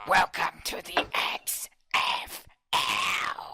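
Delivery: bad whispered announcer. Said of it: "That was horrible".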